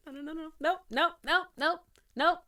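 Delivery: high, quick nopes; a tearful viewer refusing to cry, high; high repeated nope. Real, not acted.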